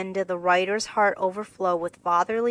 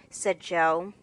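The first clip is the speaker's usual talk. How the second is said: ordinary delivery, nothing marked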